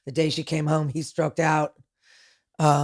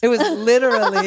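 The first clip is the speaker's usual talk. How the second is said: laughing out the words; real laughter breaking into the words; 5 semitones higher, 5 dB louder than usual. Real, not acted. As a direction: laugh through it, amazed. Laughing at the [name] reveal.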